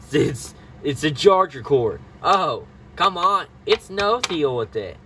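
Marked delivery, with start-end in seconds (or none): none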